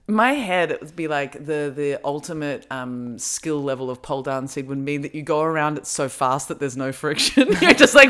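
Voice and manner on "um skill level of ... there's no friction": normal talking voice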